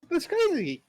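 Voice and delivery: high, slow, laughing "That's crazy"; stretched, giggly "That's crazy"; laughing disbelief. Real, not acted. Laughing at his own loss.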